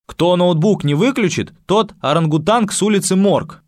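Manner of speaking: warning call. Acted. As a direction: call out over the class. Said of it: "предупредил Дмитрий".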